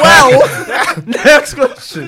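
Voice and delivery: loud high slow echo through laughter; mock-echo it, cracking up; high loud laughing repeat. Real, not acted. Echoing friend while laughing.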